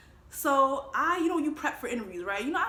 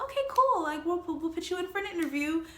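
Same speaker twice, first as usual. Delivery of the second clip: high chirpy interviewer voice; 8 semitones higher, 3 dB louder than usual. Retold or imitated.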